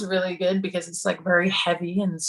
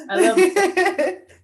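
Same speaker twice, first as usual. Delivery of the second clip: delighted; 12 semitones higher, 4 dB louder, much slower than usual. Real, not acted. Delighted reaction to being called Australian.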